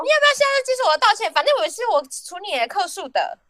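high, fast, cocky retort; sassy manager. Retold or imitated. Manager snaps back cheekily at the angry customer. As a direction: fire it back cheekily and quick. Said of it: "好呛哦".

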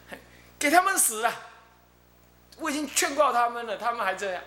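high, loud curse on the spirits; damn them, they were warned; teacher condemns the spirits, high and harsh; fierce condemnation. Retold or imitated.

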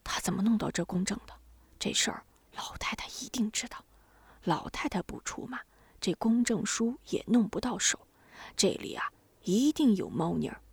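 压低嗓门，带着气声; 像背着人小声议论; 低声说话. A narrator's reading. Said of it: "然后低声说". (低声密谈)

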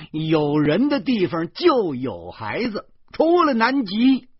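slow, high, emphatic shout; loud retort. A narrator's reading. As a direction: bellow each word out slowly. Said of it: "我大声地说".

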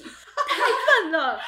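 slow, high, laughing self-scolding; mock anger at self. Sister scolding herself, slow and laughing high. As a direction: drag it out, laughing at your own stupidity. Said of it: "很气自己".

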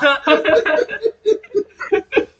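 loud, high laugh that trails off; laughing at his own comeback; high burst of laughter. Real, not acted. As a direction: crack up at your own joke. Laughs after his own punchline.

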